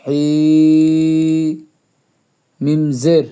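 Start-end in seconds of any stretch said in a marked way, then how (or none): 0.1-1.6 "হি" with the vowel held long on a steady note; tarikan satu alif. Shown as a demonstration. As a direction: stretch the vowel of "হি", level pitch. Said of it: "এক আলিফ".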